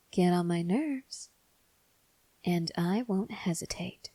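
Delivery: cold whispered threat; menacing whisper. Acted.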